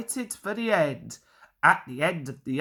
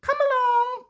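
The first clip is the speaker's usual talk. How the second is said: sharp, much higher puffing call; sharp Percy. A narrator's reading. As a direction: snap the trucks into line. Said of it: "puffed Percy sharply".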